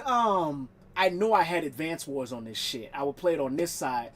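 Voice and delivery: normal talking voice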